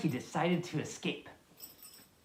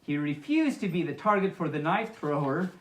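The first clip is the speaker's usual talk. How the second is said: plain reading voice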